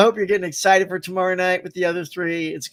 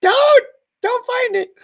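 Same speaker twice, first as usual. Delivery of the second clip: high, loud mock shout at the screen; frantic shouted pleading, pitched way up; retold yelling; 14 semitones higher, 9 dB louder, slower than usual. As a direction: yell to stop him from getting up.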